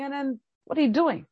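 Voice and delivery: ordinary delivery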